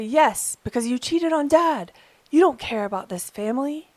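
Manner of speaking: loud, high, accusing outburst; angry child. Retold or imitated. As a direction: throw the blame like a furious teenager. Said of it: "The child's voice will be angry".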